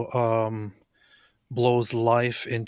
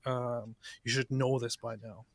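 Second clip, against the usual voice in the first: ordinary delivery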